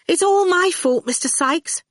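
holding back tears, high; putting on a brave face. Acted. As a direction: hold it together, close to crying. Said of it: "trying not to cry".

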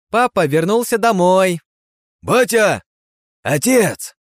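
loud, high excited cries; excited kids. Acted. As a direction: shout with joy. Children greet returning father.

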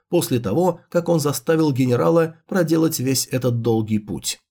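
ordinary delivery, nothing marked

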